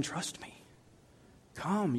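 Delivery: hushed whisper of trust; whispered reassurance. Acted. God whispering comfort to someone facing illness. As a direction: breathe it as a whisper.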